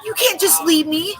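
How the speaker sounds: mocking a bratty girl's video in a high whine. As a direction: whine it like a bratty little girl. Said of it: "bratty narcissist girl".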